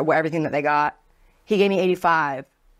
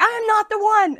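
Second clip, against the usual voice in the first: much higher and louder, challenging; mock furious coworker; 4 dB louder than usual. Redhead bartender squaring up to the rude customer, high heated challenge. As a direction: throw the threat right back at her. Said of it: "she's like".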